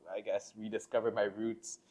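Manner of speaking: normal talking voice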